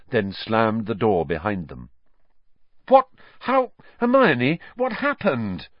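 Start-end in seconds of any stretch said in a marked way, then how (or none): 2.9-5.7 high, confused questions; bewildered. A narrator's reading. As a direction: sound completely baffled. Harry confused in cupboard.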